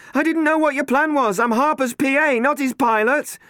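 high, loud protesting voice; Catherine arguing. Acted. Catherine to Matt in the helicopter, loud protest. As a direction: defend yourself over the noise.

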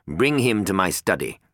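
curt order. A narrator's reading. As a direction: dismiss them coldly. Harper orders Klein.

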